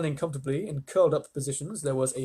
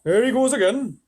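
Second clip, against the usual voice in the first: much higher, louder earthworm; earthworm cry; 9 semitones higher, 8 dB louder than usual. Acted. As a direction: complain loudly and high. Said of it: "the earthworm cried".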